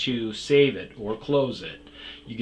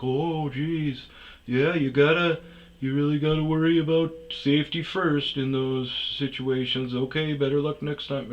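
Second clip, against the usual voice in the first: Bob; 4 semitones higher than usual. Retold or imitated. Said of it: "a droop voice".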